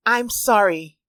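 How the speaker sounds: ordinary delivery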